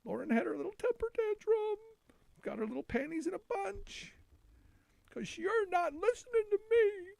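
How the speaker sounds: high, babyish sing-song mockery; mock tantrum. Host ridicules a lawmaker's temper tantrum, mocking high whine.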